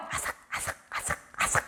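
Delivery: voiceless, hushed crunch-crunch sound effect; whispered munching. Retold or imitated. Preacher imitates a worm nibbling the gourd vine, in a whisper. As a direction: whisper tiny nibbling noises.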